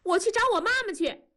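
put-on high, fast child's wail; a little girl yelling she'll go find mom; child crying out. A narrator's reading. Girl running out calling for her mom, high and fast. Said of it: "还喊".

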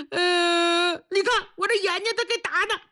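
high, tearful mock whine; whining complaint. Beaten prisoner showing his eye to police, whining. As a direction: wail your grievance in a high voice.

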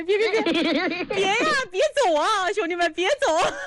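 high, clingy mock begging through laughs; hammed-up pleading landlady. Host playing the lovesick landlady begging viewers to stay, in high mock pleading. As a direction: beg them not to leave, half crying, half laughing.

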